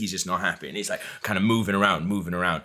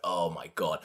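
retold dread; 5 semitones lower, 8 dB quieter than usual. Teller's dread watching the quarrel.

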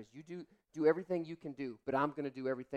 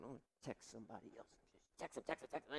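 mock eager mutter; 15 dB quieter, faster than usual. Urgently texting about gossip.

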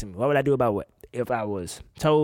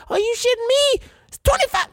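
high, louder yelp of disbelief; shocked outburst; 5 dB louder than usual. Real, not acted. Host shocked at each groomsman's share, high yelp. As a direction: recoil at the price. Sees the per-person cost.

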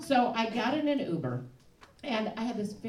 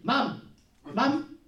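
imitated lilting West African driver, raised pitch; louder, urgent mock West African warning; West African accent; 4 semitones higher, 5 dB louder than usual. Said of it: "wonderful lilting West African accent".

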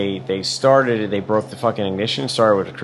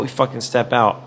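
usual speaking voice

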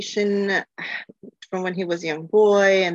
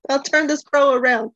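exasperated parent; 7 semitones higher, 4 dB louder than usual. Retold or imitated. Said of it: "they still treat each other like".

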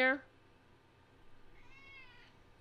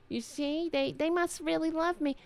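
higher mock voice, smug; mocking her voice; 7 semitones higher than usual. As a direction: smugly parrot her.

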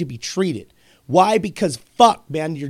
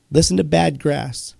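normal talking voice

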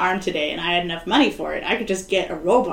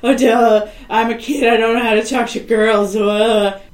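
louder mock dopey kid; hammed-up goofy kid voice; 6 dB louder than usual. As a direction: play him a clueless awkward boy. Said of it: "he's like".

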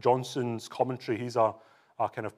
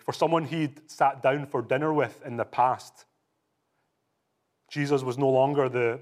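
normal talking voice

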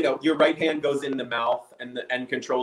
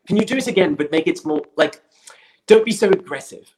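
imitating the director; 7 semitones higher, 6 dB louder than usual. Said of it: "he was just like".

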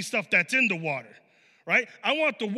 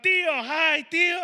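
put-on high, louder little girl's call; nieces calling out; 8 semitones higher, 4 dB louder, much slower than usual. Little nieces shouting hi to uncle during preaching, high and loud. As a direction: call out cheerfully like a small niece. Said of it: "they would start shouting out".

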